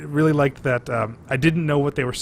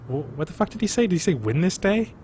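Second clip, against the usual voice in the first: puzzled question, pitched up at the end; lightly acted bewilderment; mock confusion; 4 semitones higher than usual. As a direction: act out a baffled double-check. Acts out mishearing a film line.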